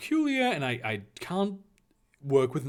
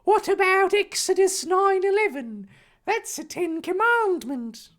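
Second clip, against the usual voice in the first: high, loud character voice; challenging Harry; 7 dB louder than usual. Acted. Harry challenging the Reverend with scripture, insistently. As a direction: pipe up with an objection.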